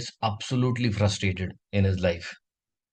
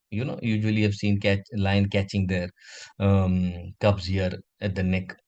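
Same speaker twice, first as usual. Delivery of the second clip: ordinary delivery, nothing marked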